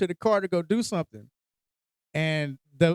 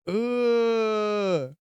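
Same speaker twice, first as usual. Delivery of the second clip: long higher-pitched "ugh", imitating a grossed-out daughter; drawn-out mock groan of disgust; 4 semitones higher than usual. Daughter hearing about unwashed hands, grossed out.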